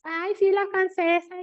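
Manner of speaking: high, slow teasing singsong; mocking classmates. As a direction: tease her like a schoolyard bully. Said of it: "me echaban carrilla a mis compañeros".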